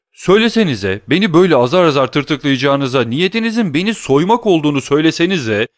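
high, loud cry of grief; outcry. A narrator's reading. Danglars crying out to the bandit, grieving and loud. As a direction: cry out as if being robbed. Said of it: "diye haykırdı Danglars".

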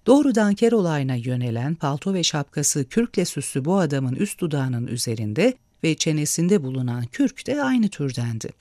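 ordinary delivery, nothing marked

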